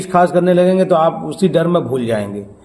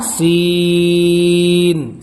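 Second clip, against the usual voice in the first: سین drawn out very long on one held note; slow, chanted, elongated سین; stretched letter; much slower than usual. Shown as a demonstration. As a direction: hold the "ee" of سین steadily. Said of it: "ان کو کھینچ کے پڑھیے".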